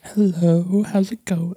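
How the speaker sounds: very high, sing-song phone greeting; exaggerated impression of a wife answering business calls; wife's professional phone voice. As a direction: put on a high-pitched, mock customer-service voice. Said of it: "professional voice".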